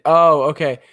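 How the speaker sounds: ordinary delivery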